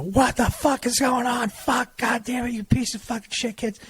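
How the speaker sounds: high, strained mock scream at the kids; mom's retold scream. Pissed mom screaming at her fighting kids, imitated shriek. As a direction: imitate mom screeching from the next room, high-pitched. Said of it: "she screams".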